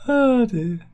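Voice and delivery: high loud falsetto "oh dear"; mock dismay. Real, not acted. Host mocking himself after a cocktail clip, falsetto "oh dear". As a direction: squeal it in fake despair.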